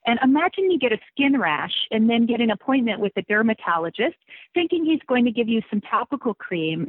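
ordinary talk, nothing marked

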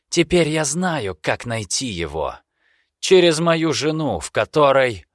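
put-on high, urgent, pleading young man. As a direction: push the deal urgently. Vester voiced in story.

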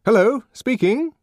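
high, loud phone greeting; answering the telephone. A narrator's reading. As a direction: pick up the receiver and announce yourself.

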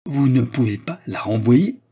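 loud, rising disbelief; astonished cry. A narrator's reading. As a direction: exclaim the question in amazement. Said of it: "s'écrièrent les deux autres".

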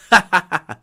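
loud bitter laugh. Acted. General laughs after refusal.

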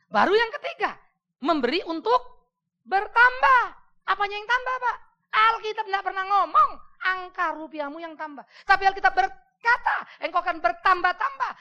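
loud, high preaching build; full-force preaching. Acted. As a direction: proclaim it to the whole church. Khotbah dengan semangat penuh. (khotbah berapi-api dengan suara keras)

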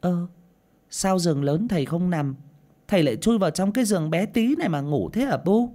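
put-on high-pitched little boy's voice; innocent child. A narrator's reading. Young son asking mother about father's coffin, innocently. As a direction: ask it as a puzzled small child. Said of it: "Nó chỉ tay vào bố đang nằm trong quan tài mà hồn nhiên".

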